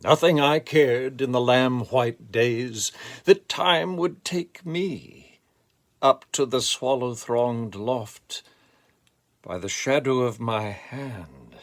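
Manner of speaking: rising, swelling lyrical recital with sweeping pitch; poem reading. Acted. Reader sharing his favorite Dylan Thomas poem, lyrical recital. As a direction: read it like a hymn to lost childhood, building to loud peaks. Reads Fern Hill aloud.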